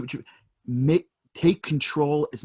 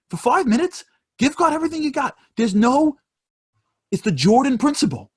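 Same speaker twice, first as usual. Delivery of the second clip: rising, louder preaching, much higher; 8 semitones higher, 4 dB louder than usual. Acted. Rabbi urging his listeners to serve God with joy, preaching at full force. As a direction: build the sermon up to a ringing peak.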